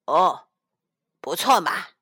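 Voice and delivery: a mild mock sneer from the tiger; in a weird voice. A narrator's reading. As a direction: say it offhand and strange, barely impressed. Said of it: "只是怪声怪气地说".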